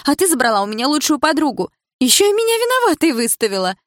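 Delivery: high, loud resentful tirade; angry rant. A narrator's reading. Milana accusing the narrator of stealing her friend, shouting. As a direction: shout your grievances at her.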